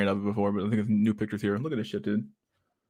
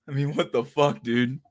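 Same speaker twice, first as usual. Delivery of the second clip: normal talking voice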